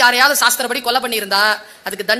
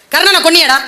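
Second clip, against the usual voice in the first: angry rebuke; 4 semitones higher, 7 dB louder than usual. Acted. Dharma rebukes Arjuna.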